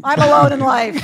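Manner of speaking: high, loud mock cry of despair; comic wail. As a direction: shout it out in hammed-up self-pity. Comic outburst about her loneliness.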